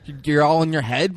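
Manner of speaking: normal talking voice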